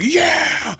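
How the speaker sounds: high, loud triumphant yell; gamer yell. Retold or imitated. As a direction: shout it like a gamer who just won. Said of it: "they get their joystick and say".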